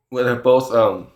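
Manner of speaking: ordinary talk, nothing marked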